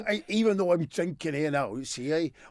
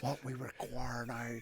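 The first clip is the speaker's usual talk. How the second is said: much softer, slow mock commentator; Dave Lanning impression; 6 semitones lower, 15 dB quieter, slower than usual. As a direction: murmur it like a darts commentator. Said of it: "That's Dave Lanning".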